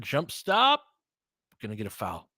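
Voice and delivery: ordinary talk, nothing marked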